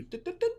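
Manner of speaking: high, bouncy beeping "dit dit dit"; playful high beep sound effect; imitated game chime. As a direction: chirp it like a little reward jingle. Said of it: "you get a little auditory cue".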